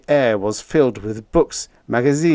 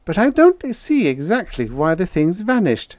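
higher-pitched, querying character voice; puzzled woman; 9 semitones higher than usual. A narrator's reading. Irene questioning Peter over coffee, puzzled.

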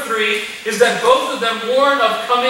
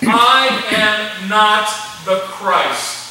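slow emphatic denial; much slower than usual. Shown as a demonstration. Slow emphatic scripture quote.

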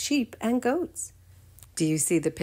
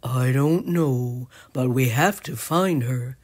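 lightly voiced Mike; 4 semitones lower than usual. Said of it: "said Mike".

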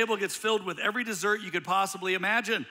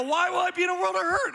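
high, fast, alarmed squeak; alarmed question; 13 semitones higher, 6 dB louder, faster than usual. Retold or imitated. Husband panicking at his wife's warning, a high, fast, alarmed squeak.

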